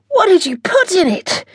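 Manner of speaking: high, loud, gasping question; captain gasping after a spicy bite. Acted. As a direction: choke the words out, burning mouth. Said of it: "he gasped".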